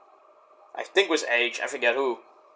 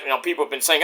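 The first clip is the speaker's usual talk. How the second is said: normal talking voice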